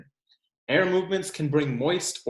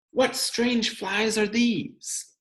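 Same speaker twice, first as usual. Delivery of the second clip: wondering; 10 semitones higher, much slower than usual. Acted. Wondering question in story.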